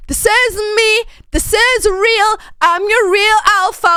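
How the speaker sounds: loud chest-thumping wolf brag; alpha wolf. Pretend. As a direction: puff up like the alpha of the pack. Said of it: "A wolf".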